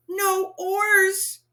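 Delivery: ordinary delivery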